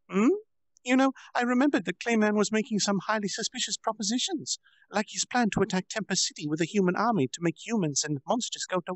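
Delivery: high, bright-voiced bragging girl; proud little girl explaining her trick; playful girl Milim. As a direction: gloat like a cheeky child.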